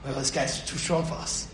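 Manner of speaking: low, hushed voice of a beaten tempter; defeated aside. Acted. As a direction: drop right down low and soft, giving up. Tempter's defeated reply after the scream.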